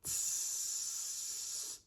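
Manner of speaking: long, drawn-out tss; imitated hissing sound. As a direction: make a sustained hissing noise, softly. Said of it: "this loud, like, tss sound".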